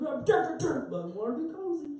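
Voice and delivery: high-pitched put-on gang member; mock cozy street gang. Comfiest street gang in sweatsuits, high mock-tough voice. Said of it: "the comfiest street gang".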